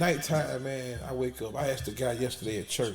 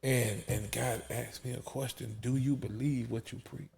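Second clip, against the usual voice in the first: soft, slowed, dropping toward a whisper; hushed testimony; 7 dB quieter than usual. Retold or imitated. Preacher recalls God's question and waking in tears, hushed. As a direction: retell it hushed, voice falling away.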